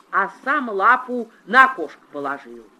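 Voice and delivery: ordinary delivery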